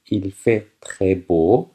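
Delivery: very slow, careful French reading; slow teacher dictation. Shown as a demonstration. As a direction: drag each word out for learners. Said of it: "teacher reads French slowly".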